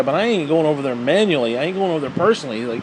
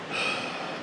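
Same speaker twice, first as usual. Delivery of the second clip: weary sigh; 14 dB quieter than usual. Real, not acted. Frustrated sigh.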